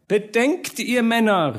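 high, thin refined orator; fine-voiced call. A narrator's reading. Elegant gentleman appeals to the burghers, fine high voice. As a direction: plead with the crowd in a high, fine voice. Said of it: "rief er mit feiner Stimme".

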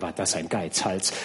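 usual speaking voice

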